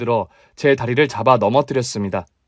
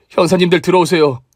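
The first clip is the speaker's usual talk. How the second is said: calling out; 6 semitones higher, 5 dB louder than usual. Acted. Calling detectives in.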